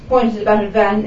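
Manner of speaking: plain reading voice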